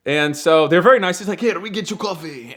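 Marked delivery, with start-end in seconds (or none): 1.4-2.5 imitating the clerk. Clerk voiced in a motel story.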